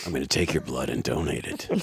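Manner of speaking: low Seagal voice. Retold or imitated. Mocking Seagal lines.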